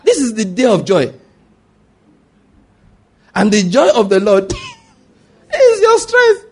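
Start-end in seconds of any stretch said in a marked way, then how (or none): 3.3-6.4 laughing, ringing high proclamation; joyful proclamation. Real, not acted. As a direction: lift it up with a laugh. Preacher proclaiming scripture joyfully.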